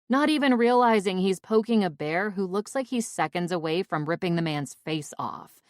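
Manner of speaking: ordinary delivery, nothing marked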